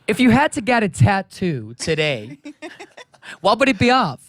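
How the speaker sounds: slow put-on American accent. British guest interviewing his friend, in an American accent. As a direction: ask it slowly as a mock American. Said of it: "when you talk like that".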